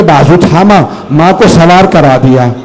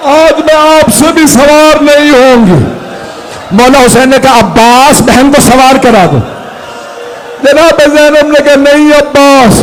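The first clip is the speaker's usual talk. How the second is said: full-force mourning sermon; 8 semitones higher than usual. Acted. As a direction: pour out grief over Zainab's farewell. Climactic lament, sustained high pitch.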